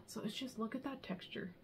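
soft hushed admiration; awed whisper. Real, not acted. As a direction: whisper over the knitting. Showing off texture.

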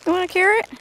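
high, sweet coaxing question to the sheep; high pet-talk voice. Real, not acted. Offering a carrot to the sheep, high coaxing pet talk.

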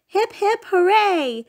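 high excited hip hip hooray; cheer. A narrator's reading. Family cheering the hero home, high excited cheer. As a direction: cheer like a crowd.

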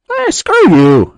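high, slow, loud jeer; squealing insult. Pretend. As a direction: spit it out in a shrill sneer. Insulting rejected entrant.

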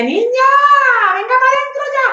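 a high, insistent mum calling out; mother calling. Retold or imitated. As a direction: call her daughter in from the doorway. Mother calls her inside.

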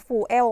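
"fuel" split into "foo-el"; the wrong "fuel", with an "oo" and then "el"; mispronunciation. Shown as a demonstration. Teacher warning the word is not said so, "fuel" mispronounced as "foo-el". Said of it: "แต่มันไม่ออกเสียงว่า fuel".

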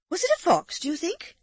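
put-on high, hesitant young wife; Amanda. A narrator's reading. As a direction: ask timidly, pitched well up. Narrator voices Amanda.

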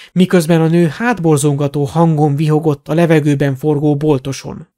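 plain reading voice

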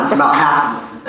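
ordinary delivery

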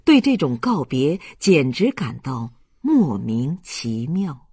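plain reading voice

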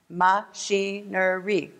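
"Machinery" slowly split into ma, chi, ne, ry; syllable by syllable. Shown as a demonstration. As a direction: say "Machinery" in four slow, separate beats. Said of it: "Four syllables".

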